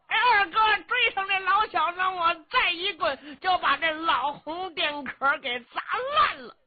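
high, loud, goofy simpleton voice; a cheeky simpleton gloating with a snicker; dim-witted young brute. Acted. Luo Shixin mocking the old general, high goofy voice.

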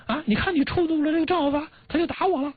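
shrill high blaming tirade; high whiny scolding. Retold or imitated. Wife scolds husband after being slapped, high whiny blame. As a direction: complain up high as if you were the one wronged. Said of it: "就骂这个".

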